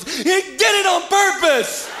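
high, slow, hammed-up wail; hollering mom. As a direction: imitate an outraged mother's drawn-out holler. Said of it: "If you keep hollering".

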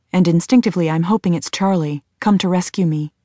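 usual speaking voice

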